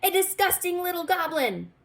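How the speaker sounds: high, loud disgusted innkeeper; disgusted cry. A narrator's reading. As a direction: spit it out in disgust. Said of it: "said the innkeeper".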